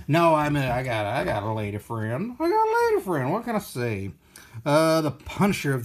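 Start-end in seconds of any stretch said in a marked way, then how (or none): 2.4-3.3 much higher, playful sing-song boast; high repeat. Pretend. Bragging to chat about his lady friend, much higher and playful. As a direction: bounce it up high and teasing.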